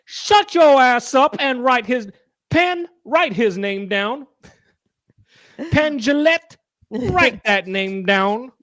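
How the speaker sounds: hammed-up rowdy host; mock showman. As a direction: boss Teller around on stage. Showman ordering Teller around.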